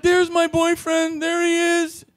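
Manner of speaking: very high, drawn-out wail; slow high-pitched imitation of her cry; mimicked woman calling out. Woman spots her boyfriend, mimicked high call-out.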